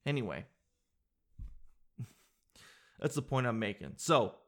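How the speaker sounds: normal talking voice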